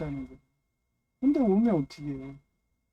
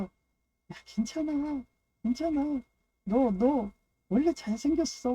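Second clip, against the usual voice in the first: high, coaxing comfort voice, slower; Nami comforting Chopper; 8 semitones higher, slower than usual. Retold or imitated. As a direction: coax and soothe a friend, pitched up. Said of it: "막 이러면서".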